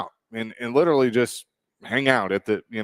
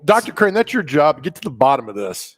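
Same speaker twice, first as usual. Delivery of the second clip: higher, louder mock-commanding voice; playful high mock order to Doctor Crane; mock dramatic boss; 5 semitones higher, 6 dB louder than usual. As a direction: hand out the case like a hammy chief. Mock assigning a case to cohost.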